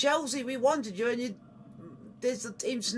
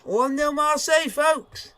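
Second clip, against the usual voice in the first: excited announcement; 6 semitones higher, 6 dB louder than usual. Real, not acted. Announcing the score.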